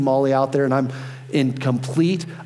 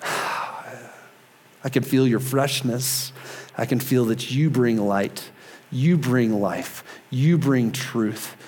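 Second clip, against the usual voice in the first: prayer. Real, not acted. Pastor leading listeners in thanks to God, prayerful. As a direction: pray unhurriedly, with pauses between phrases.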